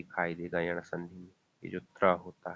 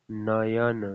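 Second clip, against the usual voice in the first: slow spelled-out word; much slower than usual. Shown as a demonstration. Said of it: "बीच में उच्चारण किए तो".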